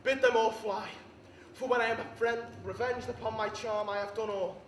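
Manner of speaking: loud, projected tragic rage; full-force stage fury; furious declamation. Acted. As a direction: roar it out as betrayed Antony. Acted rage at betrayal in monologue.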